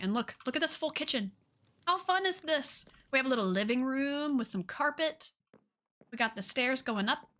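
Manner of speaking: ordinary talk, nothing marked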